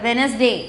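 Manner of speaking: Wednesday with no sound for the first D; silent D. Shown as a demonstration. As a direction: say Wednesday as "WENZ-day". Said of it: "உச்சரிப்பு வெளியில sound வரக்கூடாது".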